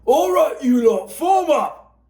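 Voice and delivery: high, loud drill-sergeant order; screamed order. Acted. Bert ordering his platoon into battle array, high loud command. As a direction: bark the platoon into line. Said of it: "Bert screamed his platoon into battle array".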